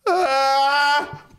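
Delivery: high imitated yell from a drunk friend; hammed-up yell; mock drunk yell. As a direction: imitate a sudden drunk cry. Sudden yell from the car.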